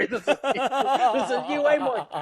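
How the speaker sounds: high, stretched words bubbling with laughter; retold "gotcha" through giggles; laughing accusation. Man sure his mate Mike is on the line, laughing disbelief.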